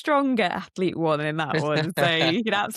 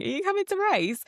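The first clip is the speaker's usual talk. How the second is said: eager call; 13 semitones higher, faster than usual. Retold or imitated. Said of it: "chased down the road saying".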